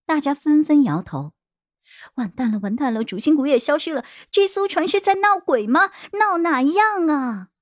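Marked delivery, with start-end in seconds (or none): 2.1-7.5 panicking at a haunted ship; acted panic. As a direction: pitch climbing into high-voiced alarm. Panic after leader vanishes.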